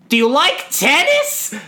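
very high, slow, hammed-up kid voice; Jonah. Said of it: "And then Jonah goes".

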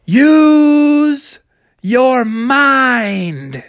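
high, long-drawn shout; crowd call. Acted. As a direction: call it out slowly in chorus. Crowd all calls out advice.

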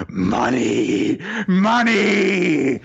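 drawn-out cries building to high and loud; greedy cry. Retold or imitated. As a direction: stretch each word, escalating into a mock yell. Character greedily chanting for money.

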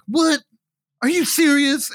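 high-pitched mock disbelief; incredulous guard. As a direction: imitate a guard who can't believe it.

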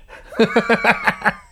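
high burst of laughter; a real laugh at yourself. Real, not acted. Laughs at own outburst.

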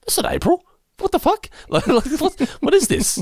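high, baffled squawk of disbelief; confused outburst. Retold or imitated. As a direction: squeak out a stunned what-the. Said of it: "I remember going".

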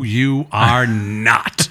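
put-on very slow, stern old lady; old woman telling the comic he's not her cup of tea; slow, firm no. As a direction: drag out every word to shut him down. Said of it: "she goes".

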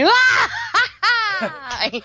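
loud high mock cackle ah ha ha; imitating maniac laugh. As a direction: ham up a crazy cartoon laugh. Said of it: "Like you're slapping your knee".

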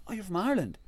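high-pitched surprised question; pleasant surprise. Retold or imitated. Consul learning the visitor is from Ireland, surprised. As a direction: imitate a delighted discovery.

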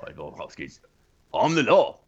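put-on Brooklyn accent. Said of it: "I'm just gonna do a Brooklyn accent".

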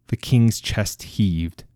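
ordinary delivery

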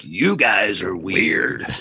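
low, very slow imitated drop; deep slow voice. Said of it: "he changed his voice like that".

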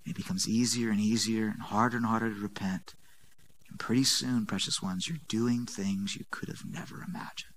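low, soft, intimate sermon voice; hushed preaching. Acted. Preacher quietly warns the congregation about sin, hushed. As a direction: draw them close, speaking low.

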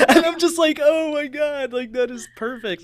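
high, loud laughing speech; laughing while talking. Real, not acted. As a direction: talk through big laughter. Laughing retelling daughter.

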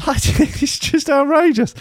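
high, drawn-out laughing protest; laughing outrage. Real, not acted. Reader reacting to a list of English faults, laughing outrage. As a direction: squeal it through laughter.

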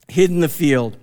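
ordinary talk, nothing marked

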